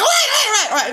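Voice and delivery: high, loud shouted pleading; mock panicked stalker. Mocking the stalker begging her to wait, high and loud. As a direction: call out wait like he's panicking.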